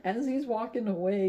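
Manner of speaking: ordinary delivery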